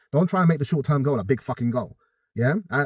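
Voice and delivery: ordinary delivery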